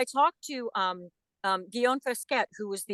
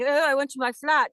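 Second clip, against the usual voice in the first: put-on higher-pitched British accent; British spy; 6 semitones higher, 3 dB louder than usual. Actor imitating a British spy talking about home, British accent. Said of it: "deciding that you're a British spy".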